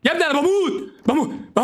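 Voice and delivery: high, pleading cries; high-pitched cry while dying in game; urgent calling for help. Real, not acted. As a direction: call out high to a teammate.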